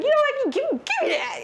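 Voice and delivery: snatch it away in a hammed-up huff; mock exasperated dad. Dad grabs the gun.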